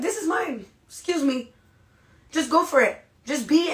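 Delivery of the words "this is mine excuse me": higher, sassy ownership; playful sassy claim, higher. Retold or imitated. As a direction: claim it with a cheeky excuse me. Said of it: "just be like".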